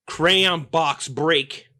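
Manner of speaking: ordinary delivery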